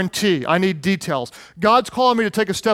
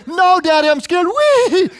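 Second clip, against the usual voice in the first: put-on much higher, louder little-boy voice sliding from fear into a slow gleeful wee; scared then thrilled child; 10 semitones higher, 4 dB louder than usual. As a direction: play the boy who's scared and loving it at once. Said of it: "I said, and then".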